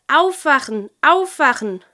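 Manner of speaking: loud, high cry to wake everyone; urgent wake-up call. Acted. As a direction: call out sharply to rouse the sleepers. Penguin urgently wakes the others.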